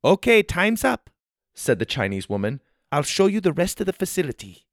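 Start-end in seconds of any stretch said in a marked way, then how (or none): 0.0-1.1 put-on higher lightly accented woman; lightly voiced tour host; Chinese woman. A narrator's reading. 2.9-4.6 put-on higher lightly accented woman; lightly voiced tour host; Chinese woman. A narrator's reading.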